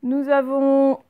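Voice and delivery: slow stretched. Shown as a demonstration. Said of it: "teacher stretches phrase".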